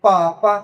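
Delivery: পা পা with P for B; Chinese pronunciation. Shown as a demonstration. Teacher showing learners the Chinese word, পা পা with P. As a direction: model পা পা as two separate syllables. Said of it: "এটা হচ্ছে হলো যে".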